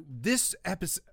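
ordinary talk

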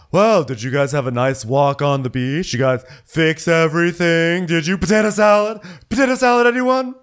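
loud, high-pitched hammed-up hostess; mock loud greeting. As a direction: belt out nosy small talk, rising to a mock shout. Acting Luann's loud, prying greeting.

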